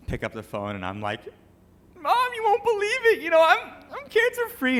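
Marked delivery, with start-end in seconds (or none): 2.0-4.8 much higher, louder, almost squealing with joy; overjoyed. Retold or imitated. Son calling his mom with cancer-free news, overjoyed. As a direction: blurt out the miracle news to mom.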